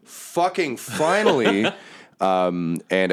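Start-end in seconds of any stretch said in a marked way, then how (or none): 0.0-1.7 high, slow, exasperated relief; fed-up soldier, higher voice; armored woman's voice. Acted. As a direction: raise the pitch and draw it out. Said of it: "the armored woman is like".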